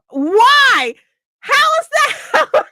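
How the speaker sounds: high, slow, shouted disbelief; disbelieving shout. Real, not acted. Reader objecting to blaming the hero, shouted disbelief. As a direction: demand an answer, incredulous.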